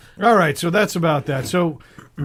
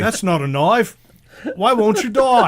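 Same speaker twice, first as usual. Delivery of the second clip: hammed-up Australian, higher pitched; Australian accent; 7 semitones higher, 3 dB louder than usual. Co-host riffing on the spider story, mock Australian accent. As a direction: put on a broad mock-Aussie yell. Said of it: "the Australian accent is just so fucking adorable".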